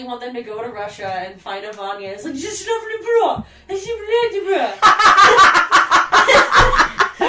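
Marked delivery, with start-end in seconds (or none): 2.3-4.7 put-on Russian accent. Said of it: "Is that your best Russian accent?". 4.8-7.2 loud breathy laugh. Real, not acted. Laughs at own accent.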